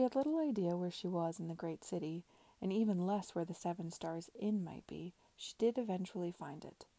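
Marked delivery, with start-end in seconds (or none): none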